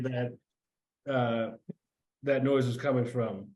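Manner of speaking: usual speaking voice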